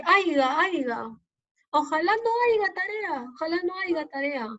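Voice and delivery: mock student wish with aiga said plainly as aiga; imitating a careless student. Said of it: "cuando dicen".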